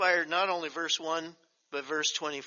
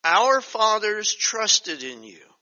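slow, louder, solemn verse; slow scripture reading; 4 semitones higher, 7 dB louder, slower than usual. Acted. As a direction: lean into each word slowly. Reads verse slowly.

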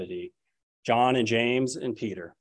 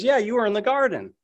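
higher, insistent accusation; another accuser; 10 semitones higher than usual. Acted. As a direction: pin him down, pitched up. Another bystander accuses Peter.

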